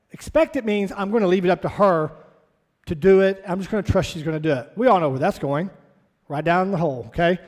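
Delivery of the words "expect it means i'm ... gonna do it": usual speaking voice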